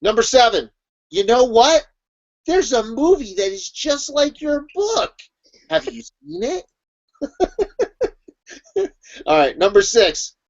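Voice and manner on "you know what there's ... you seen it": slow, high, lilting know-it-all; mock helpful remark. Acquaintance telling writer about a similar movie, mock helpful. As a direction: lift it higher into a coy question.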